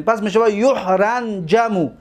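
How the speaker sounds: normal talking voice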